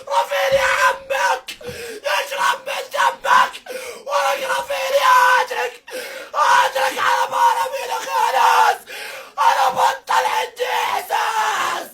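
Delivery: screaming play-by-play; full-throated live commentary; frenzied goal commentary. Real, not acted.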